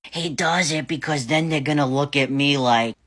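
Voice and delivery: normal talking voice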